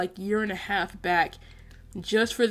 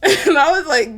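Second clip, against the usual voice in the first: a burst of real laughter, much louder and higher; laughing; 7 semitones higher, 11 dB louder than usual. Real, not acted. Recalling her crush getting beat up, bursting out laughing. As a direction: laugh out loud at the memory.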